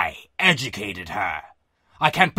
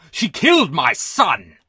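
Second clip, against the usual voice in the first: enraged shout; 4 semitones lower, slower than usual. A narrator's reading. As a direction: scream the accusation at full force. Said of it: "he shouted".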